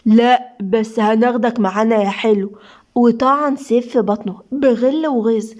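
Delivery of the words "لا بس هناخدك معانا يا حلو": ordinary delivery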